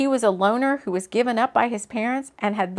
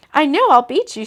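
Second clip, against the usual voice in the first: higher, louder playful challenge; teasing; 6 semitones higher, 8 dB louder than usual. A narrator's reading. Woman accepting her date's challenge to run, teasing. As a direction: tease him with a lifted voice. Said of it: "she said, teasing him".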